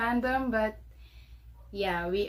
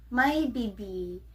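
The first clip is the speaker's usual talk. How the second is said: normal talking voice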